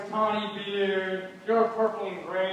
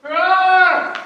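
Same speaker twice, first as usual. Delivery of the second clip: loud, higher roaring like a lion; 8 semitones higher, 12 dB louder than usual. Acted. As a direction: roar to frighten the ladies. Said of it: "for it is nothing but roaring".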